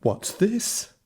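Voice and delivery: high, surprised mother's voice; a startled mum exclaiming. Acted. Mum startled by beans on the table, exclaiming. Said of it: "exclaimed his mum".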